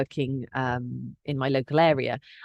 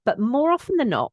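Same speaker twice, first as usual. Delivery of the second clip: ordinary talk, nothing marked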